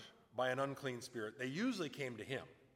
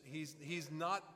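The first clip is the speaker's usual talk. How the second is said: ordinary talk, nothing marked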